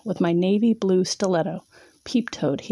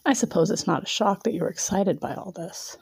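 plain reading voice

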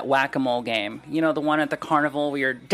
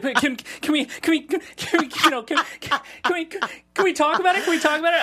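high, panicky, stammering plea; anxious pleading; 14 semitones higher than usual. Retold or imitated. Boyfriend anxiously begging his girlfriend to talk, high and panicky. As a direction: fret and beg to talk it out. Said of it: "I'm almost hyperventilating".

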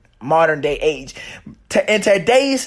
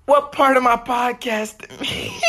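laughing; 8 semitones higher than usual. Real, not acted. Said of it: "Can't even say that with a straight face".

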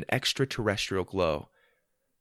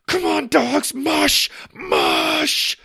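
loud panicked shouting; freaking out; 6 dB louder, much slower than usual. Acted. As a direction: shout commands frantically, stretching the last one. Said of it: "start to freak out".

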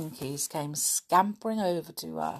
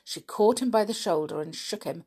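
usual speaking voice

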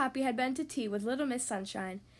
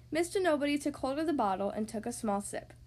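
ordinary delivery, nothing marked

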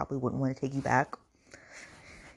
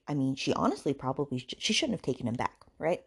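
ordinary delivery

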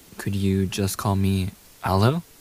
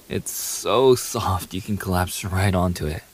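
ordinary talk

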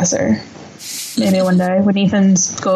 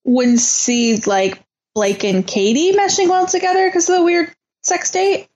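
rising in disbelief; incredulous; 8 semitones higher than usual. Real, not acted. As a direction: question it in disbelief. Incredulous echo of the question.

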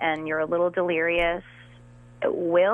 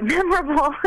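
laughing; 11 semitones higher than usual. Real, not acted. Laughs at own remark.

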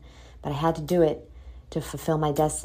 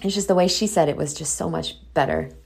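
usual speaking voice

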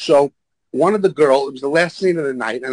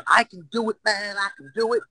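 high, soft eager pleading; insistent kid impression; 6 semitones higher, 4 dB quieter than usual. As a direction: lightly imitate a cocky young installer. Installer kid insisting he can.

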